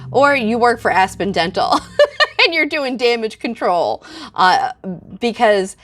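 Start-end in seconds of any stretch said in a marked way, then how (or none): 1.8-2.4 a quick real laugh at her own jab; short laugh. Real, not acted. Laughing at her joke that critics work for the dentist. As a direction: burst out laughing at the idea.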